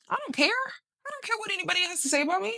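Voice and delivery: high-pitched, breezy brush-off; defiant self-talk. Retold or imitated. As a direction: rattle off a high, defiant dismissal. Acts out her defiant self-talk.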